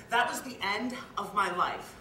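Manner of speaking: loud, emphatic lament; anguished outburst. Acted. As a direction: let the despair burst out loudly. Father laments his son's diagnosis.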